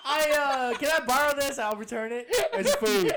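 high and loud, laughing throughout. Retold or imitated. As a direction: talk while cracking up. Laughing retold child talk.

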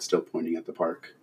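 plain reading voice

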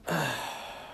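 long, breathy sigh; heavy sigh. Real, not acted. Fan mourning a wrestler he felt was misused, heavy sigh. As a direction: let out a weary exhale. Pauses in upset tribute.

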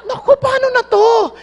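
high, loud mock panic; hammed-up frightened hunter. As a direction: cry out in alarm.

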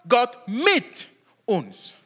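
slow "Gott mit uns" with the weight on "mit"; drawn out slowly, leaning into "mit"; stress on mit. Shown as a demonstration. Preacher repeats the second stress slowly, on "mit". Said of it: "Die zweite Betonung ist mit".

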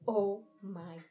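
loud, slow horrified cry. A narrator's reading. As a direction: cry it out in horror. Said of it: "I cried".

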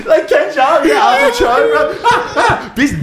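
very high, talking through big laughter; real laughing. Real, not acted. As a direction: crack up while getting the words out. Cracking up at the comparison.

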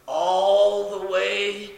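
"all the way" stretched slowly; drawn out long. Shown as a demonstration. Preacher lugging trash up the driveway, "all the way" drawn long. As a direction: drag out "all the way" to exaggerate the distance. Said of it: "Made it sound long".

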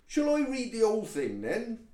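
high, loud West Country offer; megaphone-loud. Acted. Toad offers to read the whole memo, booming loud. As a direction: project as if to a whole hall. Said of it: "Would you like a megaphone".

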